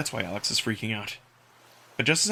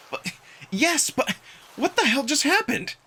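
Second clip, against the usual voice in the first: higher incredulous stammer; flustered confusion; 13 semitones higher than usual. Acted. Margot asks Amicus what happened, flustered. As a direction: sputter in disbelief. Narrator confused after rescue.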